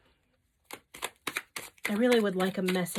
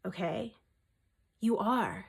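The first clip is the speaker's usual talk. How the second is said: usual speaking voice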